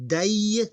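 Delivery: Day sung out high and loud, long vowel; Arabic syllable day. Shown as a demonstration. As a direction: model Day clearly for the learner. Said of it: "See, this is day".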